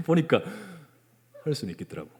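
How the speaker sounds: usual speaking voice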